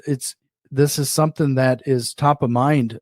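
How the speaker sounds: ordinary talk, nothing marked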